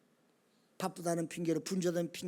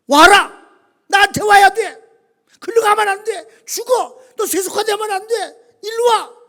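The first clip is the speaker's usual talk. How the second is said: high, very loud commanding shouts; God calling out; 12 semitones higher, 17 dB louder, slower than usual. Acted. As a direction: summon straying people back with urgent shouted orders. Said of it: "하나님의 콜링의 시간이다".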